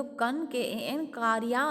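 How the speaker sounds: कन spelled out K-A-N, then कारिया; pronunciation breakdown. Shown as a demonstration. Teacher showing how the lake's name is pronounced, कन spelled out before कारिया. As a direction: break it into कन and कारिया, naming the letters. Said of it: "pronunciation अगर हम करने जाएंगे तो".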